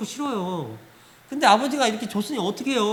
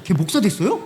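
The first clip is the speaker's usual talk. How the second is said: startled question; 5 dB louder than usual. Retold or imitated. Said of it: "목사님 깜짝 놀랐어".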